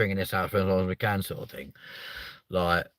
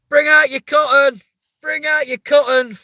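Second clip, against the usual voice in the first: very high, loud calling cry; mock town crier; 11 dB louder than usual. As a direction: bellow it like a plague-cart crier. Mock plague-cart cry.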